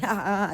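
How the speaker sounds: loud, high taunting ha ha ha; mocking laugh. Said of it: "So I laugh like".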